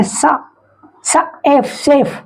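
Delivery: "सा", then "एफ", then blended "सेफ" as shef. Shown as a demonstration. Teacher blending chef for learners, "सेफ" begun with a sh sound. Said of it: "यहाँ CH का उच्चारण क्या है".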